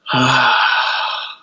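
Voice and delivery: long audible sigh out; dramatic sigh. Shown as a demonstration. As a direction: let out a big sigh through the mouth. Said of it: "a big sigh out through your mouth".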